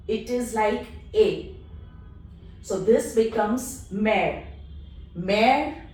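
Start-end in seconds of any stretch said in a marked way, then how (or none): 1.1-1.5 A said as "ay"; E sounded as A. Shown as a demonstration. Teacher showing E mid-syllable, A said as "ay". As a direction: give French E the sound of A, "ay". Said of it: "When placed in the middle of a syllable".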